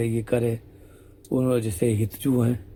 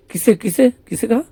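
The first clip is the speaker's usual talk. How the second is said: feigned innocence; 8 semitones higher, 3 dB louder than usual. Acted. Sakhi plays innocent, confused.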